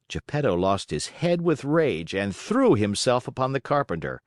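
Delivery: ordinary delivery, nothing marked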